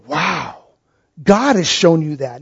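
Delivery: hushed amazement at the answer; awed Jesus. Retold or imitated. Jesus voiced answering Peter's confession.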